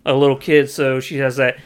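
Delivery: ordinary delivery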